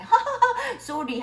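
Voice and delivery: imitating a mocking ha-ha before sorry; joking laughed apology. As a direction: act out a laugh-through sorry. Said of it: "장난으로 웃으면서". (장난으로 웃으며 하는 사과 흉내)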